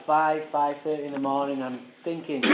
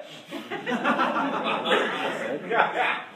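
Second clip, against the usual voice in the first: breathy laugh; 4 semitones higher than usual. Real, not acted. Telling the crowd about his old retort, a breathy laugh.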